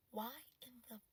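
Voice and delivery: unvoiced, breathy whisper of disbelief. Real, not acted. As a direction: mutter it under your breath.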